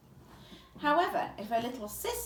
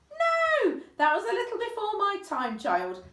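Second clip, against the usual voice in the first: amused reply; 11 semitones higher, 8 dB louder than usual. Acted. Old man amused reply.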